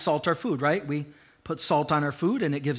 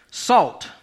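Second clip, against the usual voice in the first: ordinary talk, nothing marked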